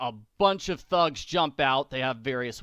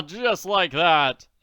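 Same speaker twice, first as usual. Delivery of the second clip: much louder, higher jovial taunt; hearty booming Daddy; 3 semitones higher, 8 dB louder than usual. Acted. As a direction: boom it with mock cheer.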